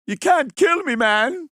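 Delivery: high, loud mock protester shout; defiant protester. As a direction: yell it like you're untouchable.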